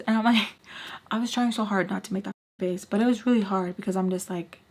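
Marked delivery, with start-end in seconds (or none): none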